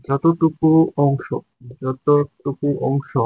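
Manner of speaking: very slow, each word drawn out for note-taking; slow dictation. Shown as a demonstration. Teacher dictating a definition to students, very slowly. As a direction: spell it out slowly so students can write. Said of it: "teacher repeats the definition for notes".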